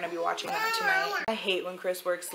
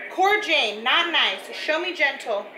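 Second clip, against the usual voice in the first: firm scolding; 6 semitones higher, 6 dB louder than usual. Real, not acted. Mom scolding baby who hit her.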